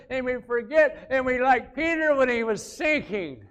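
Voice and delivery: loud, high, driving preacher voice; preaching at full force. Acted. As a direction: push it out like a rising sermon.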